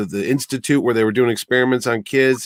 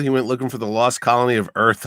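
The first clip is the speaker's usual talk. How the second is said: ordinary talk, nothing marked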